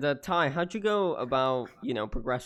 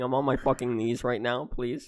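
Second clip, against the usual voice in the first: normal talking voice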